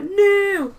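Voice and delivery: loud, high mock wail; mock wailing protest. Hurt kid refusing the hospital, loud mock wail. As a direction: cry out a hammed-up refusal.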